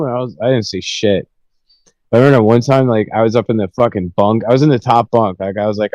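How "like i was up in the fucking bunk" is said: ordinary delivery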